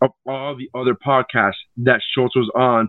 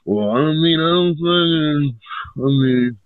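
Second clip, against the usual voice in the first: hemming and hawing, dragged-out words; mock squirming, drawing out each grudging phrase; reluctant admission. Comedian retelling his reaction to a critical video, reluctant admission.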